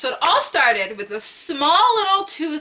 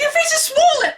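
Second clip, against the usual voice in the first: much higher alarmed exclamation; mom shocked; 4 dB louder than usual. Retold or imitated. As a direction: blurt it out in alarm. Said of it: "She's like".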